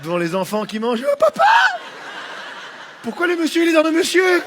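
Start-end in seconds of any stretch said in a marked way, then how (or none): 1.1-4.4 put-on child voice. Child voice asking father.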